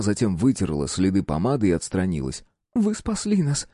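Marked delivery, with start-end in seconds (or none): 2.7-3.7 grateful woman. A narrator's reading.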